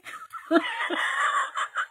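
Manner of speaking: high squealing laugh. Real, not acted. Laughing at her own story.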